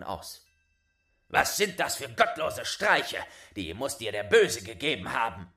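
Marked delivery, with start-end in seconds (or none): 1.3-5.5 loud, indignant scolding. Acted. Father scolding his son for his pranks. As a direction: scold him hard.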